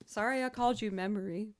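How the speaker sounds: ordinary delivery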